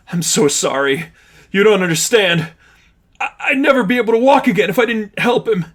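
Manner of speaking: high, rushed pleading; desperate apology. Acted. Richie apologising to his friends, fast desperate pleading. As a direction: beg forgiveness frantically.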